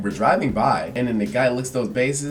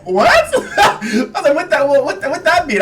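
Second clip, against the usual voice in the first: much higher, louder, stammering what; shocked disbelief; 7 dB louder than usual. Retold or imitated. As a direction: squeak out your disbelief, laughing. Shocked reaction to racist remark.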